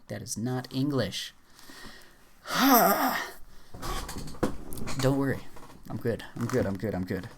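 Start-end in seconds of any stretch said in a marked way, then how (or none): 2.4-4.9 big high breathy sigh of exhaustion; rising sigh, fed up with the list; long weary sigh. Real, not acted. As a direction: let out a long tired sigh. Weary after long word list.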